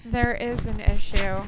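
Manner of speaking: slow, booming into the mic; right in your ear. Real, not acted. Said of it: "put it closer to my mouth".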